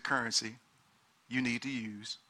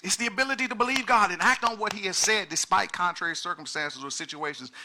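usual speaking voice

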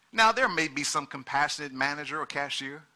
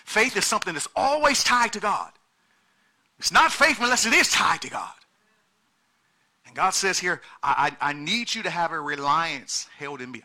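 loud, high, driving preacher's cry; preaching at full force; 4 semitones higher, 5 dB louder than usual. Acted. Pastor pressing the congregation on currency, full-force preaching.

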